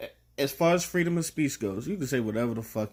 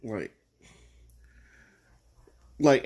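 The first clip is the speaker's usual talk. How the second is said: ordinary delivery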